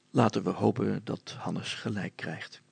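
plain reading voice

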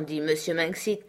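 usual speaking voice

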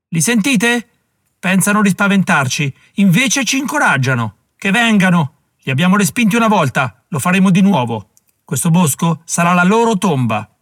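alto e forte, incitante; arringa gridata. Acted. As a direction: sprona gli uomini a gran voce. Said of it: "Parlò con quanta voce aveva". (il generale incita la milizia gridando)

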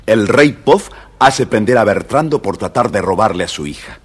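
usual speaking voice

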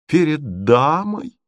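slow, high, incredulous echo; drawn-out outraged question; indignant cry. A narrator's reading. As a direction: repeat it back in scornful disbelief. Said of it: "вскричал Мозгляков".

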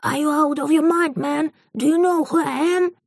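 high, put-on voice yelling in English; furious foreign cameraman. A narrator's reading. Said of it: "заорал".

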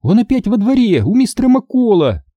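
high-pitched alarmed report; girl's cry. Acted. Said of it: "закричала Минди". (тревожный высокий крик)

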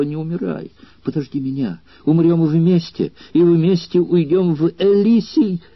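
whispered plea. A narrator's reading. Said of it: "и прошептала".

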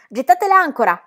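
shouted order. A narrator's reading. Sailor ordering crew to drop anchor, commanding shout. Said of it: "ordinò Book".

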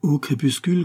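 usual speaking voice

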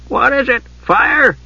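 put-on high-pitched cracked old monk. A narrator's reading. Elderly monk woken by the bell, demanding in a cracked voice. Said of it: "demanded a cracked voice".

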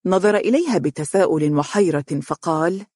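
plain reading voice

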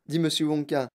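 ordinary delivery, nothing marked

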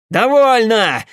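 high, loud cut-off command; shout. A narrator's reading. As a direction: shout to seize everyone's attention. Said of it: "закричал Пахомов". (властный громкий крик)

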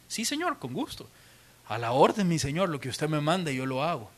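mock-happy, then soft and humble; gladly at your service; eager to please. Preacher voices a cheerful Ananías accepting the Lord's order. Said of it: "Ananías está de lo más feliz".

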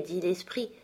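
ordinary delivery, nothing marked

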